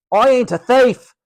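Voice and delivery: put-on loud, high Cockney boy's protest; indignant shout. A narrator's reading. George denying Matron's slur, indignant shout. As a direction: shout back in your own defence. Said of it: "shouted George".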